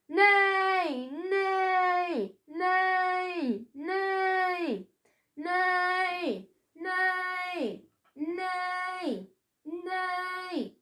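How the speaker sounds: neigh and let it fade to nothing. A narrator's reading. Billy keeps neighing as a centaur, fading out. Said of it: "went Billy".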